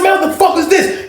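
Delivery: high outraged yell with a laugh; angry shout. Retold or imitated. Angry player yells at the cheat, laughing high. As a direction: bark it out in disbelief.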